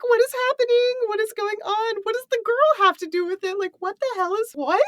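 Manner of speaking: high-pitched, frantic, overexcited questions; excited screaming. Retold or imitated. Host reliving reading the twist, high excited shrieks. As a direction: squeal it like a fan losing it. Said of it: "I'm, like, screaming".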